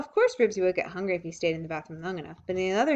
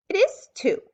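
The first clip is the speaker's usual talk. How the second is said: put-on much higher, louder defiant child; 12 semitones higher, 7 dB louder than usual. A narrator's reading. Ramona defying Mother, high and loud. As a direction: fire it back like a stubborn kid. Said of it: "said Ramona defiantly".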